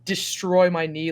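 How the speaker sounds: usual speaking voice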